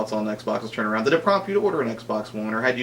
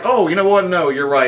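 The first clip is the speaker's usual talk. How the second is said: mock apology; 6 semitones higher, 8 dB louder than usual. Mocking Microsoft's imagined apology.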